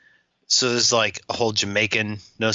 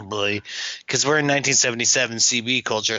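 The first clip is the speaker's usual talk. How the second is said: normal talking voice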